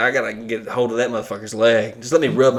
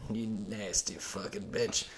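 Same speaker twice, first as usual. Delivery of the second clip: quiet mock leer; 4 semitones lower, 18 dB quieter than usual. Mock lusty talk to sweaty woman.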